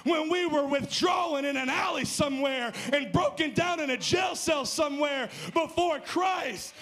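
rising, shouted preacher's climax; preaching at full force. Acted.

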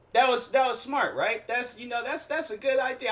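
sarcastic. Real, not acted. Sarcastic praise of dumb plan.

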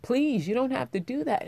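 put-on high, pleading voice of a young boy; lightly voiced kid waving off the money; earnest boy saying no thanks; kid refusing money. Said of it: "he just looked at me like".

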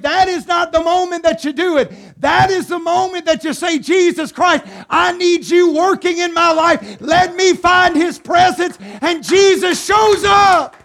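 shouting preacher climax; full-force preaching. Acted. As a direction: drive it home to the congregation.

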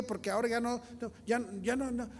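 usual speaking voice

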